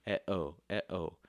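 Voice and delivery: "eh-oh" as bare vowels, weight on "oh"; light "eh", stressed "oh" in "eh-oh"; unstressed-stressed. Shown as a demonstration. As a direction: beat out "eh-oh" without consonants. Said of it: "It goes unstressed, stressed".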